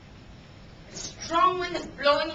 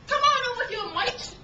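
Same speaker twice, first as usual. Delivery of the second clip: loud, high New Zealand call; New Zealand accent; 9 semitones higher, 4 dB louder than usual. Retold or imitated. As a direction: imitate a New Zealander calling someone over. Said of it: "in a typical New Zealand accent".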